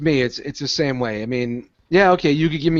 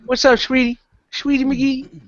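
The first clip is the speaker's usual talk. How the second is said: high, sing-song cooing to a pet; pet talk; 10 semitones higher than usual. Real, not acted. Host greets Wookie in the corner, high pet talk. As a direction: coo it up high to your pet.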